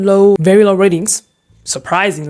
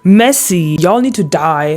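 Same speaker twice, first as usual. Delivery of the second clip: usual speaking voice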